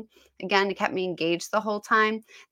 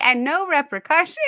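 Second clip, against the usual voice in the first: mock badass, pitched up; 8 semitones higher, 4 dB louder than usual. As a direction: act out a badass dragon's line, higher. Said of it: "he just says it like a badass".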